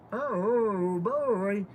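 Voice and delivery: very high, drawn-out mock cooing; imitated follower voice. Streamer showing her dog how followers sound, high mock coo. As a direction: squeal softly like a besotted fan. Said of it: "They're all saying it in that voice".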